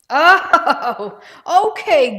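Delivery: a loud, high laugh of surprise. Real, not acted. Host laughs when viewer Gus corrects the model number, loud and high. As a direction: burst out laughing at being caught out.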